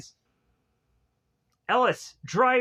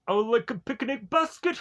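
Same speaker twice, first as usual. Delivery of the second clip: lifted Yogi Bear cartoon voice, trailing into a laugh; higher-pitched cartoon bear, playful; 5 semitones higher than usual. Podcaster quoting a cartoon bear's line, cartoon bear voice. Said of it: "actually does Yogi Bear".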